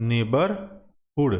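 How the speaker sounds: slow teaching pronunciation. Shown as a demonstration. Teacher spells out neighbourhood for students, slowly. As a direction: say neighbourhood very slowly, drawing out each syllable.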